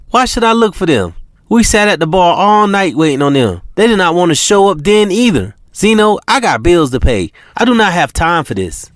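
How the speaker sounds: high-pitched woman's voice, indignant rant; fed-up young woman complaining; China's rant. Acted. China ranting at Zeno.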